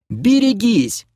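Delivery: high-pitched urgent shout; warning cry. Acted. Kira whirls round to warn all, crying out. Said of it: "в голос крикнула Кира".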